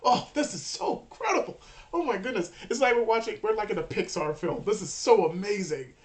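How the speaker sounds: loud, excited, hammed-up marvelling; gushing spectator. Game master voices audience believing it's special effects, loud gushing. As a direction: gush in mock delight.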